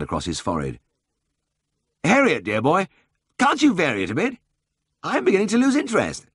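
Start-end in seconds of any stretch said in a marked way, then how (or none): 2.0-6.3 high-pitched, wearily protesting lord; plaintive upper-class complaint; pleading aristocrat. A narrator's reading. Lord pleading against monotonous readings.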